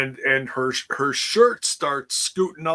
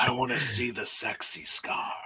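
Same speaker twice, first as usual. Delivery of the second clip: a touch of a mock seductive purr; lightly sultry joke voice; 3 semitones lower than usual. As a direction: tease it like a bad pickup line. Repeating the scar line, jokingly.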